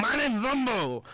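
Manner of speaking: high, eager put-on chef voice; high comic Italian-ish chef blurt; Zumbo character voice. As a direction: barge in as the excitable chef Zumbo. Guest character bursts in.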